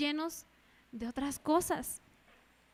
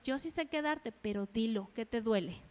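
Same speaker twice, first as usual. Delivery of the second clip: soft, low, calm urging; hushed and lower, coaxing an answer; the Lord, gentle; 4 semitones lower, 7 dB quieter than usual. Acted. The Lord to a hurting believer, gentle urging. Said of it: "el Señor a veces así es".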